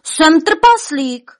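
slow, high, loud proclamation; roaring declaration. A narrator's reading. Giant Vladimír announces his costume, roaring declaration. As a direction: roar it out, stretching the words. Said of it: "zařval Vladimír".

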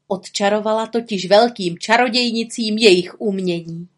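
plain reading voice

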